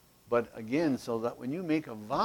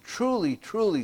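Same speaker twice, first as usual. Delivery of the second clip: "Truly, truly" pitched high, each word falling; emphatic truly; 8 semitones higher, 3 dB louder than usual. Shown as a demonstration. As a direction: stress both "truly" from high up. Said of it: "Jesus is making an emphasis here".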